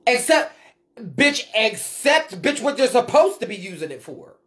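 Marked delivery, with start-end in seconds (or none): none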